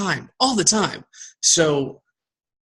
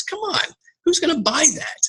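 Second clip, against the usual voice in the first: incredulous. Real, not acted. Incredulous rhetorical question.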